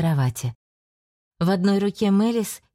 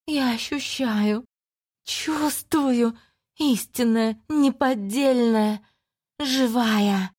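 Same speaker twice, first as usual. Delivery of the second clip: high, slow, ecstatic demonic hiss; creeping nightmare whisper; 6 semitones higher, much slower than usual. Acted. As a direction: hiss it like hungry darkness. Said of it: "восторженно прошипела рваная тьма".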